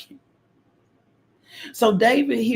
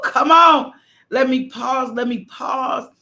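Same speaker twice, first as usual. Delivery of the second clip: preaching shout; 6 semitones higher, 5 dB louder, slower than usual. Real, not acted. Preaching at full force.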